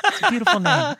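[name] impression. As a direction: push her voice all the way up. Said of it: "Then I'm gonna have to impersonate Reva".

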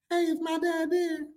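high, slow, sweet mock-polite knock; overly nice little voice she never used; a polite request she never made. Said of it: "didn't knock on the door like".

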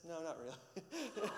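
lightly voiced three-year-old owning up; toddler's honest answer. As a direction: play the blunt toddler, slowly and giggling. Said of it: "He says".

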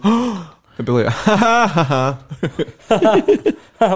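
loud, high mock laughing ha ha; mock audience laughter. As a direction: imitate a crowd roaring with laughter.

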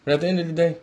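ordinary talk, nothing marked

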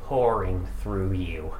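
somber narration. A narrator's reading. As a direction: wind the story down gravely. GM closing narration.

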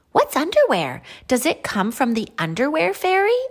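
high, loud storybook character; naive question. Acted. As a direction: ask in wide-eyed wonder, voice lifted high. Naive veggie asks about underwear.

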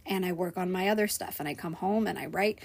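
ordinary talk, nothing marked

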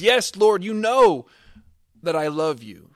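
high, loud anguished answer; Peter's plea. Acted. Peter answering Jesus the third time, high and anguished. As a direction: cry it out in anguish. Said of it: "And Peter says".

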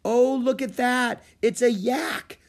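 high thrilled squeal; excited girl. A narrator's reading. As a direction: burst out with delight. Annie sees a yak.

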